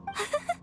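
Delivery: a quick giggle of delight; bright delighted laugh. Acted. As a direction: laugh with pure joy. Excited girl laughs at offer.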